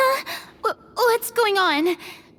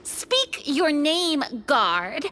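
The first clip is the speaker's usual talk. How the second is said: stern command; 6 semitones lower, much slower than usual. Acted. Hero confronts the traitor guard.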